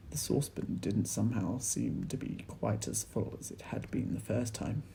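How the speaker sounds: ordinary delivery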